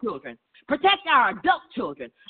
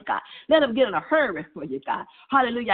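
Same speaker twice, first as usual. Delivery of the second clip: usual speaking voice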